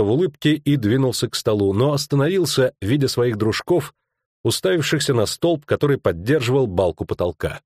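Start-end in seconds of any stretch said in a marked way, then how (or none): none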